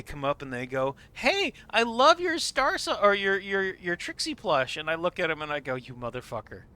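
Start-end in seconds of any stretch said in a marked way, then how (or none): 1.1-4.8 high, bubbly imitation of an admirer; gushing fan. Fan approaching someone about their plush, high mock gushing. 5.8-6.6 low, softened retold curse; under the breath. Muttering at a stranger who misnamed the plush, under the breath. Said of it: "that was under my breath".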